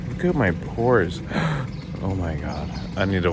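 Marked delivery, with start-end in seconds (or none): 1.3-2.0 gasp. Real, not acted. Shocked at seeing his pores.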